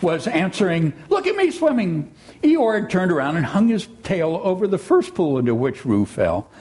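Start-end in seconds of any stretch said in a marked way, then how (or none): 1.1-2.1 squeaky Roo. A narrator's reading. Roo voiced again.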